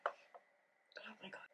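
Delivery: breathless whisper. Real, not acted. Reader overwhelmed by her own writing, whispered. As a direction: whisper it in disbelief.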